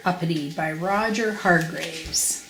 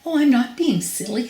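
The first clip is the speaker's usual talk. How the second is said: higher, lightly voiced goblin; goblin protesting; 6 semitones higher than usual. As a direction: protest lightly in a raised pitch. Goblin answers back, higher voice.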